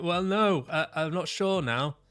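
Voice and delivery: put-on high, wobbly little kid voice, lightly done; unsure kid. Kid reacting to the parent's panic, mild high uncertain voice. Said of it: "starts, like, freaking out".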